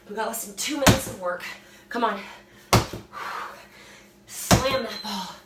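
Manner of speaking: pump-up coaching shout; urging coach. Acted. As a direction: drive them through the last reps. Coach urging through last minutes.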